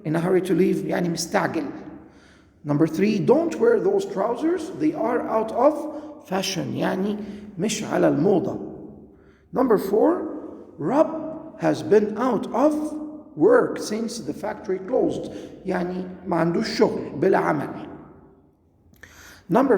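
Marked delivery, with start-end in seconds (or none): 2.6-6.8 slow, careful reading of the example sentence; slow exercise read. Shown as a demonstration. Teacher reads exercise sentences. 9.5-15.3 slow, careful reading of the example sentence; slow exercise read. Shown as a demonstration. Teacher reads exercise sentences.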